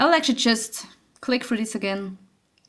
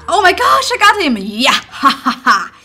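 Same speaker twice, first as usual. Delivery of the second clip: triumphant shout; 3 semitones higher, 7 dB louder than usual. Real, not acted. Gamer cheering loudly after killing the monster. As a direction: cheer the kill.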